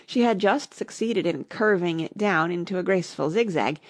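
usual speaking voice

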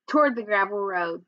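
plain reading voice

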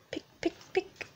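barely a whisper, like a tiny beak; fading storybook peck. A narrator's reading. Egg pecking, faintest.

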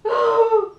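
high, loud mock sob; crying. Rabbit wails for a carrot, hammed-up sob. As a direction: wail it like a sad little bunny. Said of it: "Lapin cries and says".

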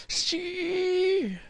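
high joyful cry; joyful scream. Real, not acted. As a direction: scream with delight. Penalty saved, joyful scream.

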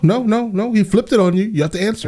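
high-pitched excited no no no. Real, not acted. Streamer hyped, mocking interviewer's point.

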